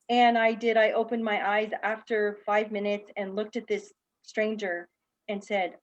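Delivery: usual speaking voice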